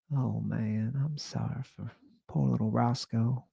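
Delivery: high, soft camp Southern pity; hushed high Southern condolence; Keith's flamboyant Southern voice. Retold or imitated. Keith pitying poor Roscoe to Andy, soft high Southern voice. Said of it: "this is how he talked".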